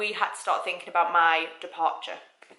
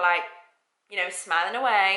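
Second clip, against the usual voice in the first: normal talking voice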